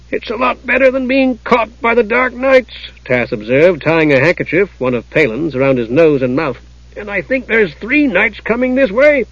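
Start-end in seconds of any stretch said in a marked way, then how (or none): 0.1-2.7 high-pitched matter-of-fact kender; observant Tass. Acted. Tass voiced in narration. 6.9-9.2 high-pitched kender warning; alerting Tass. Acted. Tass voiced in narration.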